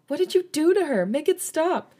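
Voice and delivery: high panicked scream. A narrator's reading. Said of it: "Minnie screamed at Taeyong".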